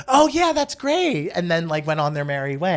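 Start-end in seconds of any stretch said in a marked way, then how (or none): 0.1-1.3 breezy brush-off. Retold or imitated. Teens brushing him off.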